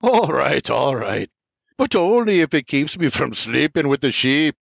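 chuckling. A narrator's reading.

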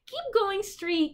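high, slow sing-song counsel; a high, slow tempter voice; coaxing voice. A narrator's reading. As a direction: lure them on slowly. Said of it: "counseled the voice".

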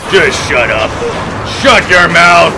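loud furious yell; angry shout. Acted. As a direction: bark it at the enemy. Yelling at enemy.

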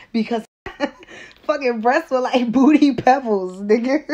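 laughing through the words. Real, not acted. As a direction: crack up while saying it. Laughing while telling.

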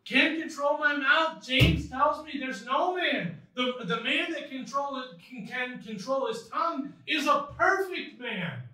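high, loud Southern hillbilly voice; hillbilly accent. Acted. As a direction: make the excuse as a loud hillbilly. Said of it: "felt very hillbilly-ish".

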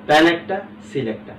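normal talking voice

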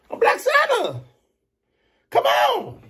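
very high, excited boast; high, hyped self-announcement; hyped exclamation. Real, not acted. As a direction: shout out your persona with hype.

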